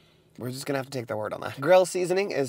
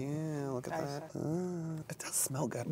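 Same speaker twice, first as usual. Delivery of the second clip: quiet; 2 semitones higher, 11 dB quieter, slower than usual. Real, not acted. Smelling the burger up close.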